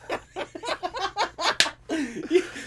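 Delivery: long, high-pitched giggle; drawn-out high laughing fit. Acted. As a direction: crack up softly at your own joke. Caller laughs at own dragon joke.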